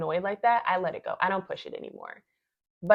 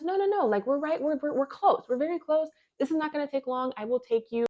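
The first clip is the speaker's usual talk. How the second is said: higher-pitched imitation of an insistent driver; mock hasty reassurance, pitched up; 7 semitones higher than usual. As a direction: wave off the worry in a raised, retold voice. Driver brushes off her request.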